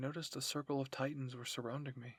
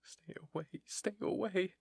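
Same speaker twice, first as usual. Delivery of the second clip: acted panic, small then pitched up; frightened plea; 6 semitones higher than usual. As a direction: voice climbing in fear. Cornered character pleading, shaking.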